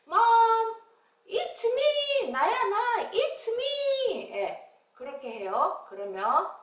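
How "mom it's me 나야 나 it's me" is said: very high, singing call at the door; child calling Mom. Acted. Child back from kindergarten calling mom, very high call. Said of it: "그러면 친구들이".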